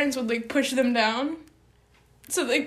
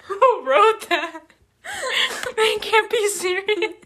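laughing; 8 semitones higher, slower than usual. Real, not acted. Mocking affirmations, laughing.

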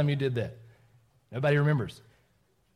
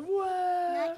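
mock yell. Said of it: "You yelled really loud? Like".

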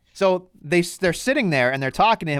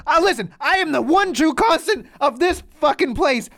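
high-pitched imitated outburst; Seth Rollins; 9 semitones higher than usual. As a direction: act out a wrestler losing it, pitch jumping up. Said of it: "Seth Rollins literally loses his shit".

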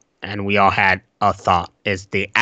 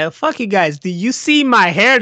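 higher, indignant mock doll; offended Chucky voice; 11 semitones higher, 5 dB louder than usual. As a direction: whine about the haircut in a put-on Chucky voice. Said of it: "So he's like".

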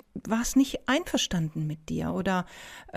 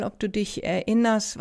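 ordinary delivery

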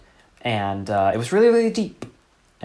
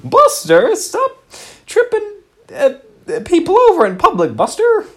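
very high, loud, fretful mock voice; silly character voice; 9 dB louder than usual. Playing along, begging Buster in a very high loud squawk. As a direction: squawk it in a panic. Improv game.

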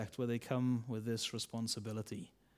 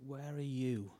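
soft, quiet repeat; hushed question; 6 dB quieter, slower than usual. Shown as a demonstration. Preacher asking the congregation, hushed and quiet. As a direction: let the question fall quietly. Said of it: "quiet final repeat".

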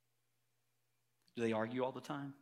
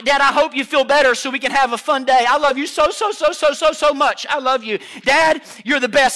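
lightly imitated child, loud and higher; kids' talk; 7 semitones higher, 15 dB louder than usual. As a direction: gush a little like a kid to Dad. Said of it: "This is how kids talk".

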